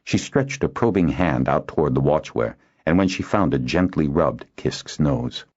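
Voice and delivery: ordinary delivery, nothing marked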